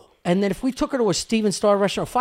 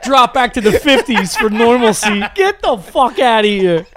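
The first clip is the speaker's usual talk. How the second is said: loud, laughing put-down; laughing ridicule; 4 semitones higher, 8 dB louder than usual. Real, not acted. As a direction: scoff while laughing.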